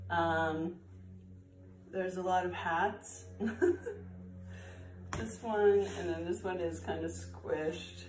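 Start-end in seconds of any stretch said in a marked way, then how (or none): 3.3-5.1 a bright high giggle. Real, not acted. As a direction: burst into a quick giggle. Laughing at the pile of hats.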